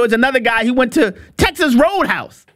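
ordinary talk, nothing marked

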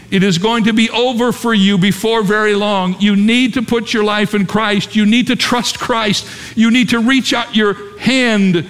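high, insistent deathbed appeal; pleading vicar. Retold or imitated. Vicar urging dying man to trust Christ, urgent pleading. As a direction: press the plea home, pitched well up. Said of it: "he was pleading with this man".

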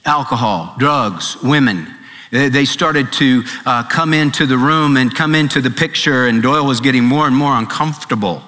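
ordinary talk, nothing marked

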